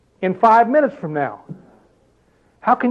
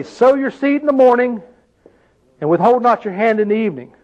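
higher, raised reading of the verse; scripture reading; 4 semitones higher than usual. Acted. Preacher rephrasing the verse for the congregation, raised. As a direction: proclaim the verse to the room.

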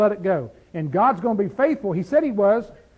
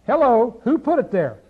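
ordinary talk

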